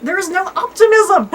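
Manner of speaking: laughing it out at the gloomy koala. Real, not acted. Laughing about the pessimistic koala.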